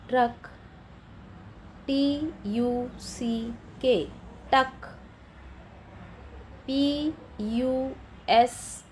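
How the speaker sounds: slow, letter by letter spelling; teacher spelling out words slowly. Shown as a demonstration. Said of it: "spells words for children".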